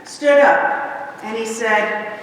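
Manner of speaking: ordinary delivery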